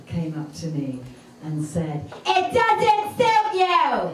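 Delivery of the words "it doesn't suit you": loud, high, slow bellow; blunt mock shout. Customer judges her dress, blunt loud shout. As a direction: belt it out high, stretching each word.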